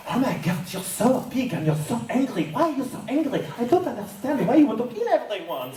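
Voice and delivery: high-pitched, distressed character voice; put-on high voice, baffled and upset; France. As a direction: plead in a high, bewildered voice. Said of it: "like, France would be like".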